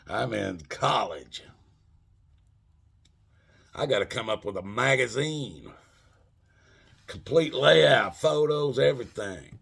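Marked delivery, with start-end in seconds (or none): none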